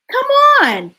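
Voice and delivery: high, loud beckoning call; eager call. Acted. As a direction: call brightly for a friend to follow. Cat calls duck to follow.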